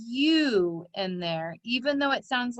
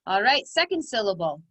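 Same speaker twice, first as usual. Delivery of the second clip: ordinary talk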